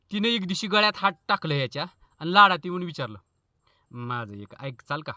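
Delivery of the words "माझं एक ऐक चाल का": coaxing wife. Acted. Wife asks sweetly.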